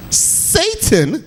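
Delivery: high, drawn-out Church Lady "Satan". Retold or imitated. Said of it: "I thought you was gonna say it like the church lady".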